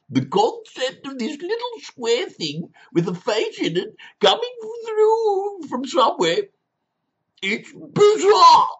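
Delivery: rising to a very high, loud, slowed squeal; shrill outraged persona. Acted. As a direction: build from prissy to screeching disbelief.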